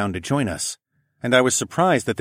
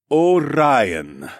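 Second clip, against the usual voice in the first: slow, heavy alien man; 5 semitones higher, 5 dB louder than usual. A narrator's reading. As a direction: say it slow and flat. Tall alien voice.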